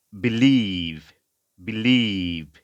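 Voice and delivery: "believe" with a long, strong second syllable; stress on LIEVE. Shown as a demonstration. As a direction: lean on "lieve" in "believe", stretching the ee. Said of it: "stress হচ্ছে লিভের উপরে".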